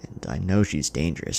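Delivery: ordinary delivery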